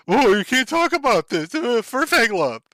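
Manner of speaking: hammed-up nasal scold; mock furry moderator. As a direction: sneer at a prissy moderator. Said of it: "and be like".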